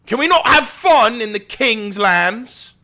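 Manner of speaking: high, slow theatrical cry; mock-grand proclamation. Streamer answering a chatter about having fun, as a mock-grand proclamation.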